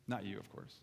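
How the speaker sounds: low, hushed reassurance; joking aside. Real, not acted. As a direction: slip it in quietly under your breath.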